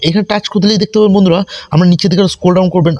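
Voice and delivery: ordinary talk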